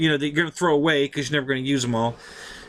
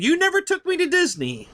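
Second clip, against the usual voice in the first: high, stunned disbelief; can't believe what he's hearing; incredulous; 13 semitones higher than usual. Retold or imitated. Questioning dad who denies the trip, incredulous.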